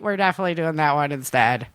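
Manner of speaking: usual speaking voice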